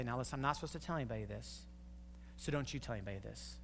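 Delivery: low, hurried secret-telling voice, fast; mock hushed gossip. Preacher mimics a gossip telling a friend, hushed and hurried. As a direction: lean in and pass on the secret quickly.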